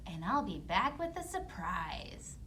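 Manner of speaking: sneaky whisper. Acted. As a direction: whisper the false promise. Whispered lie.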